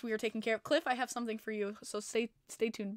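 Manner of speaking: normal talking voice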